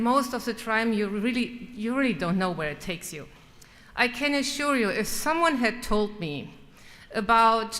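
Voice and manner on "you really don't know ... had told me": normal talking voice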